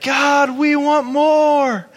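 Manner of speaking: high, loud, slow drawn-out plea; hammed-up loud crying prayer; imitated desperate cry. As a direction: cry out to God, stretching every word. Imitating the screaming man.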